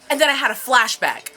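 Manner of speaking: normal talking voice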